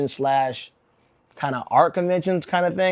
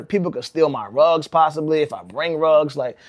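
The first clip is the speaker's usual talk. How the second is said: ordinary delivery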